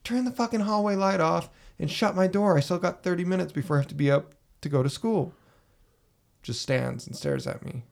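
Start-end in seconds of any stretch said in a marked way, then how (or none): 0.0-5.3 higher-pitched retold yell at a sister. Retelling yelling at sister to shut the door, pitched-up mock yell. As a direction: call it down the hall, annoyed, pitched up. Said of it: "So I yell".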